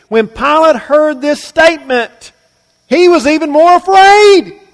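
preacher's climb to a near shout; rising emphasis. Real, not acted. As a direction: drive the line up with intensity. Preacher building on Pilate's fear.

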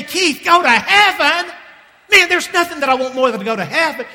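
high, loud, stretched exclamation; fervent eagerness. Acted. Congregant answers Keith about wanting heaven, fervent eagerness. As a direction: cry out as an eager believer.

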